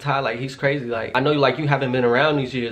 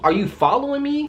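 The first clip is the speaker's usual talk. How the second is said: shocked question; 12 semitones higher than usual. Retold or imitated. Confronting him at the pump, stunned high question. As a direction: pitch jumps way up in disbelief.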